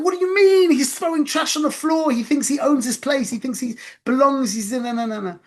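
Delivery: high, loud retold outburst easing off into muttered sputtering; very upset. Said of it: "I got very, very upset".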